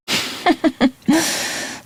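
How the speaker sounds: a short, high, airy laugh; teasing chuckle. Acted. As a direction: laugh off his fear lightly. Teasing the captive about drowning.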